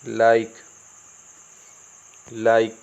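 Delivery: लाइक ending on k, paused between; taught reading repeated. Shown as a demonstration. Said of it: "क्या होगा?". (लाइक को "ए" के बिना दोहराएँ)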